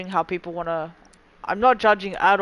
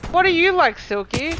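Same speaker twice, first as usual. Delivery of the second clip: high playful voice; 10 semitones higher, 5 dB louder than usual. Acted. Playful high put-on question.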